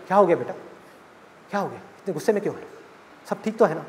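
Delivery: soft, anxious father voice, hurried; a lightly voiced father calming his angry daughter; worried father. Father on phone calming his upset daughter, hushed and anxious.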